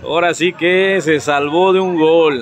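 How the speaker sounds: loud, lively match call; live play-by-play. Real, not acted. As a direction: call the attack loud and quick.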